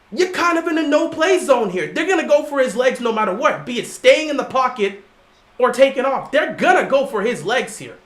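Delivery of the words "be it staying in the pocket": usual speaking voice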